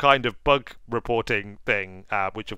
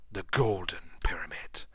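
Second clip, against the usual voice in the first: hushed, breathy reverence for the name; awed whisper; 9 semitones lower, 10 dB quieter than usual. Acted. Gamer whispering the treasure's name in awe. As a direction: whisper it like a sacred place.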